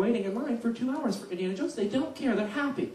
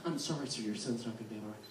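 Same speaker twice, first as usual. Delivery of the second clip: soft apology; 11 semitones lower, 14 dB quieter, much faster than usual. Retold or imitated. Employee's apology quoted.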